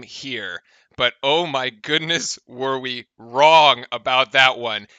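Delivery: casual low-key recap voice; highlights commentary intro. Acted. Host setting up the highlights, relaxed.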